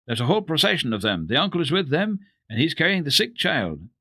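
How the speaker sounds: fast, high eager telling; excited child's news. Acted. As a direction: rush the news out breathlessly. Same excited report continues.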